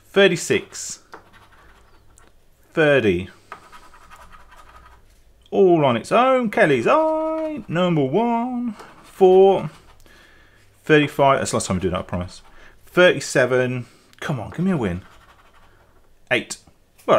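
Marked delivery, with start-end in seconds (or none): 0.1-11.4 bingo caller. Acted. Bingo call for number one.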